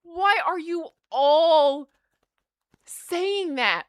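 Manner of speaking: slow, high exasperated demand; exasperated plea. Retold or imitated. Patient telling staff to shut up, exasperated. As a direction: tell them to shut up, pleading. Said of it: "I'm like".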